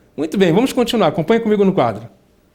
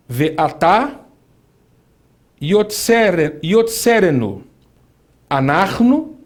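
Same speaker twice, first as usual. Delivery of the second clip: slow scripture reading; slower than usual. Shown as a demonstration. Reads Hebrew verse slowly.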